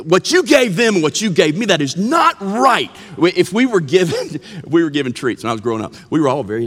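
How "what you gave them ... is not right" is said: higher-pitched mock outraged child; hammed-up protest at unequal shares; indignant kid complaint. Acting a child's unfairness complaint.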